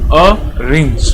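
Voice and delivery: ऑरेंज said slowly, with the open "aw" at the start made clear; teacher modelling ऑरेंज for students; careful demo pronunciation. Shown as a demonstration. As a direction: model the word ऑरेंज for the class, opening on a clear vowel. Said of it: "pronounce करता हूं तो मेरे पास आएगा ऑरेंज".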